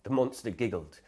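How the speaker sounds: plain reading voice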